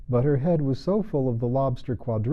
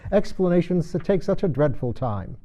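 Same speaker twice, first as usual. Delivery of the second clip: impatient; 7 semitones higher, 4 dB louder than usual. Acted. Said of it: "in an impatient tone".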